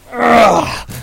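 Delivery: loud strained grunt while pushing; effort grunt. Acted. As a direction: heave against the door with a grunt. Straining to barricade door.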